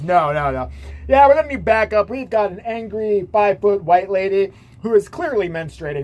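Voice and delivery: a hint of urgent backup call; mock officer on radio. Officer calling backup on teacher, mock urgent report.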